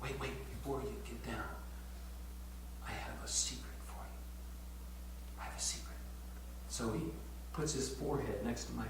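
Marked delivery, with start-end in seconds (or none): 0.0-6.0 hushed secret. Retold or imitated. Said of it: "and so I said to him".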